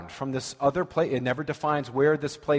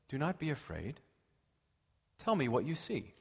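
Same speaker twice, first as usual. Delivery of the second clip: hushed reassurance; 10 dB quieter than usual. Retold or imitated. Saul soothing the medium.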